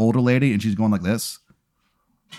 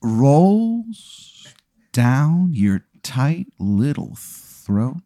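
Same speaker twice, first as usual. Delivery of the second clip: slow, drawn-out, suggestive description; teasingly stretched-out storytelling; savoring narration; 3 semitones higher, much slower than usual. Acted. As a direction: linger on each word of the sip. Game master describes whiskey suggestively.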